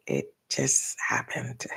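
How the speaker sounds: slow hushed aside with a small laugh; whispering. Real, not acted. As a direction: whisper it sheepishly. Embarrassed aside after yawn.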